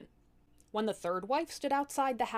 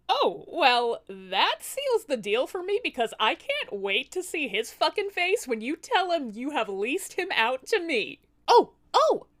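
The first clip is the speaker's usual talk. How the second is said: loud, high gloating glee; gleeful demoness; 6 semitones higher, 7 dB louder than usual. Acted. Demoness gloating over leasing the husband, loud and gleeful. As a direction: relish the husband's coming shock.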